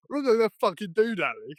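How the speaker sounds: high, loud cocky mimicry of a mate; mock defiant refusal. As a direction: throw it back like a stroppy teen. Mimicking friend refusing again.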